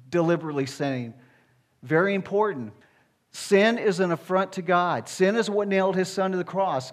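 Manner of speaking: usual speaking voice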